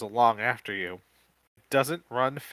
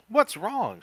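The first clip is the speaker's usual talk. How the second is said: worried question; 12 semitones higher, 5 dB louder than usual. Acted. Kim anxious in cave.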